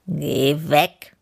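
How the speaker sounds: lightly voiced grumpy bear; a touch of bear grumble; grumbling bear. A narrator's reading. Bear sends the cheeky hare away, mildly grumbling. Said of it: "grummelte der Bär".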